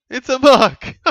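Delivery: high, loud disbelief; can't believe the umpire paid it; incredulous cry. Real, not acted. Unbelievable mark paid.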